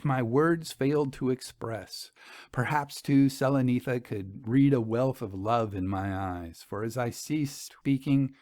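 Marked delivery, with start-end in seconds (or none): none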